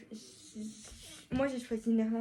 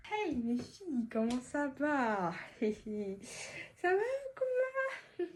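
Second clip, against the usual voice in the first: mocking greeting; 2 semitones higher, slower than usual. Boys greeting the girls to tease them, mocking. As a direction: taunt them with slow, exaggerated vowels and a giggle.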